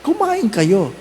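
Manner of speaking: ordinary delivery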